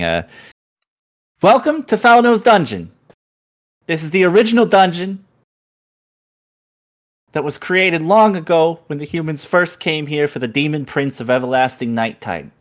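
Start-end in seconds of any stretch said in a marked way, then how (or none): 1.4-12.5 very high, loud squeaky spirit; shrill skull voice. Acted. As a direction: shriek it like a floating skull. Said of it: "a shrill voice just going".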